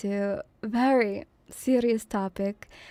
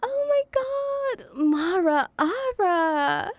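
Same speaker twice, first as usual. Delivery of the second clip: high-pitched, delighted squeal of welcome; excited greeting; 8 semitones higher than usual. Real, not acted. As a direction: greet a friend in a high, drawn-out coo. Delighted greeting of a visiting streamer.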